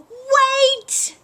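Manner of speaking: loud high shout; thundering shout. A narrator's reading. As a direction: thunder it out to stop him. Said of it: "Moose thundered".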